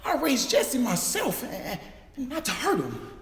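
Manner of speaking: put-on a man pleading to spare a boy; pleading warning. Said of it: "I told that man".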